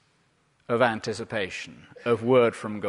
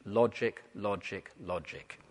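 "Logic" said three times, each one softer and lower, dying away to almost nothing; fading repetition; 6 semitones lower, 10 dB quieter, slower than usual. Shown as a demonstration. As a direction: let "logic" fade out over the three repeats. Sermon point repeated, fading away.

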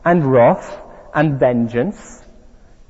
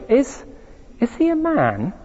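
put-on higher, hesitant girl's question; rather nervous; 7 semitones higher than usual. A narrator's reading. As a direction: ask nervously, stumbling on the first word. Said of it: "Lucy says rather nervously".

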